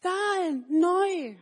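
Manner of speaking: put-on high, loud childlike cry; simple boy shouting about his sandals; Manni's excited call. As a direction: blurt it out with joy. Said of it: "und dann sagt er".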